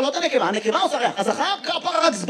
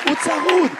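hoarse; 3 semitones higher than usual. Retold or imitated. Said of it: "אתה צרוד".

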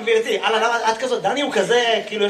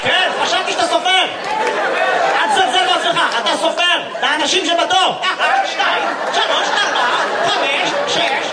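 higher, teasing exclamations; hammed-up high-pitched counting; mock enthusiasm; 6 semitones higher than usual. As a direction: raise the pitch and tease with playful insistence. Mock praise, counting people in queue.

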